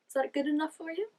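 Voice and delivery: tossed off with a smirk; smug. Retold or imitated. Vlogger mimicking George's smug line to Bobby, lightly smug. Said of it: "he's so smug".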